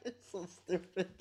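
loud burst of laughter; cracking up at a dumb joke; laughing hard. Real, not acted.

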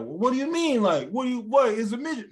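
high-pitched baffled protest; indignant relative. Retold or imitated. Relative arguing back about the word, indignant. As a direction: imitate an incredulous family member.

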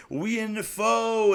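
high, drawn-out imitation of bragging; mock boasting. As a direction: stretch the words high, showing off. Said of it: "he starts popping his shit".

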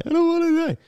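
high-pitched whiny wail; high whine. Retold or imitated. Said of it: "Sorry for doing an accent".

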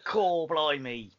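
high, very slow mock Cockney; stretched-out stock Cockney exclamation, hammed up; bad Cockney accent. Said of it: "the Cockney accent".

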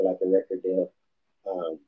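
ordinary delivery

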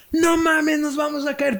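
high yelled panic on the ride; shouting to scare my cousin; retold scream. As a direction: scream it as the coaster seems to break. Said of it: "pero yo gritaba".

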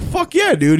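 high-pitched eager yes; enthusiastic. Retold or imitated. Retelling a yes to a friend's invitation, enthusiastic. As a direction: leap up in pitch, all for it.

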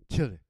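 put-on "Children" said as "chil-druh"; mimicked pronunciation. Shown as a demonstration. Comedian mimics how they say it, "Children" with a swallowed ending. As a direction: say "Children" with the final n dropped. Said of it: "They say it like that".

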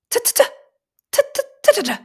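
tiny bird pecking, very high "tit, tit"; high tapping bird sound. A narrator's reading. Bird pecking near the drowsy little elephant, very high tapping. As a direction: peck it out in a squeaky high voice. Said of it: "machte es wieder".

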